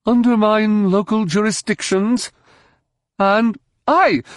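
high-pitched proclamation; high, emphatic speechifying; declaiming. A narrator's reading. Villain declaiming his philosophy.

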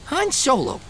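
put-on slurring, tipsy young man; town drunk. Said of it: "slurring his words".

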